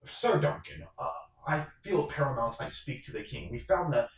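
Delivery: hurried report to his lord; urgent knight. Acted. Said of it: "And [name] goes".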